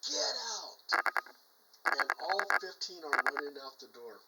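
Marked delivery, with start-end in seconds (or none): 0.1-0.8 voiceless, forced whispered command; harsh whisper, like a spirit over a walkie-talkie; menacing ghost man. Retold or imitated. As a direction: imitate a ghostly man ordering people away. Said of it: "that man's voice says".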